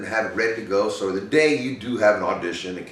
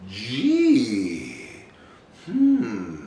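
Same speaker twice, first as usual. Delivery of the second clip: wondering. Acted. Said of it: "You gotta wonder".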